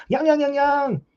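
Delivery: high, ringing fake Korean-Chinese gibberish; mock Chinese shouting. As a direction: yell crude imitation Chinese syllables. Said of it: "speaking in Korean or speaking in Chinese".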